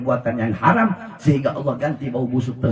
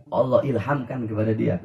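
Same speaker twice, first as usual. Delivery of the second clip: normal talking voice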